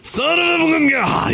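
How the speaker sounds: high, slow, drawn-out exclaim; hammed-up cry of pain; mock outburst. Co-host hit by a thrown chip, hammed-up exclaim.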